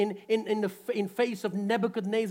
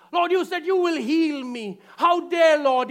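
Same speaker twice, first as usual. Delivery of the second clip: louder, higher, indignant; indignant complaint; 8 semitones higher, 8 dB louder than usual. Retold or imitated. A believer scolding God in mock indignation. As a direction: demand an answer from God. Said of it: "our attitude".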